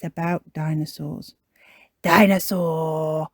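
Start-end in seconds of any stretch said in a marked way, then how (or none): 2.0-3.3 put-on loud, drawn-out toddler word; George Pig. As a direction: stretch it out like a dinosaur-mad toddler. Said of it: "says George".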